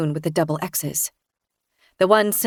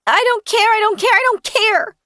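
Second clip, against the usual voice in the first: high, loud chanting tantrum; child tantrum; 5 dB louder than usual. Acted. Sam melting down on the beach, loud high tantrum. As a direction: scream it again and again like a hurt kid.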